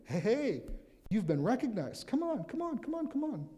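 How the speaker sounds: high, loud excited calling; very high, loud, eager come on calls; eager crowd. Acted. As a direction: wave him over, suddenly thrilled. Crowd suddenly eager, calling him over.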